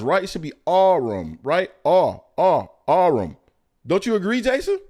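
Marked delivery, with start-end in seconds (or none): 0.2-1.4 "Arum" said "AH-rrum", rolled and loud; insisting pronunciation. Shown as a demonstration. Host jokingly insists co-host should say "Arum" his way. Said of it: "like two R's". 1.8-3.4 "Arum" said "AH-rrum", rolled and loud; insisting pronunciation. Shown as a demonstration. Host jokingly insists co-host should say "Arum" his way. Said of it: "like two R's".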